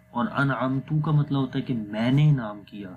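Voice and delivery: usual speaking voice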